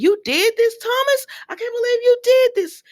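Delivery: outraged father. Retold or imitated. Ridge confronts Thomas, outraged.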